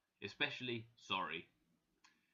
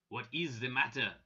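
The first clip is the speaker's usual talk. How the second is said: put-on louder, French-accented question; strong French accent; 5 dB louder than usual. A narrator's reading. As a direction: ask with a heavy French accent. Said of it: "in his strong French accent".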